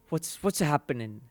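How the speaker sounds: ordinary talk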